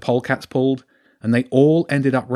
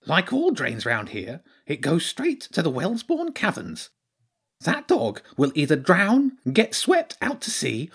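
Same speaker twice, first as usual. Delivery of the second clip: high creature voice, spelling out doom; gloomy hedgehog character; 7 semitones higher than usual. Acted. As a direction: warn grimly in a high little voice.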